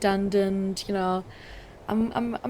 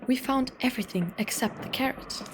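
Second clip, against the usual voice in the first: ordinary talk, nothing marked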